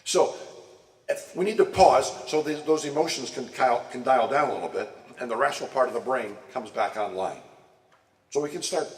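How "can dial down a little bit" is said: ordinary delivery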